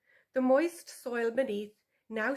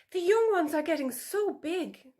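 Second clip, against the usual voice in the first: higher, louder sweet-talking fox; butter up the sow with a higher voice; appeasing vixen; 7 semitones higher, 5 dB louder than usual. A narrator's reading. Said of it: "Tula said".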